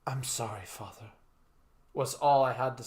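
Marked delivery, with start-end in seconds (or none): 0.1-1.1 low, soft apology trailing off; mildly broken, grieving farewell; voice cracking with grief. A narrator's reading. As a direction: let it sink almost to nothing, grieving. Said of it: "My voice cracked and I broke down momentarily".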